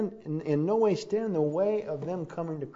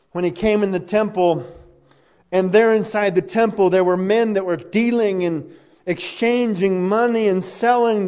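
loud narration building higher toward a shout; forceful preaching; 7 semitones higher, 9 dB louder than usual. Real, not acted. Preacher retelling Jesus clearing the temple, loud and building. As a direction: drive the story harder as it goes.

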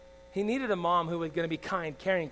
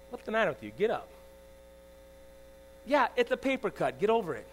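lightly voiced impatient father, pitched up; mock tough dad; 3 semitones higher than usual. Preacher as his old self to his hurt son, dismissive. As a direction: brush off a hurt child, imitating a tough dad. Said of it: "That was the dad I was".

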